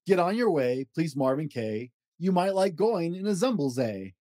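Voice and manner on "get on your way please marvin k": plain reading voice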